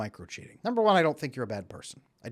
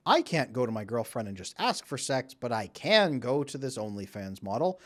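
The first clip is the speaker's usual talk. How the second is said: normal talking voice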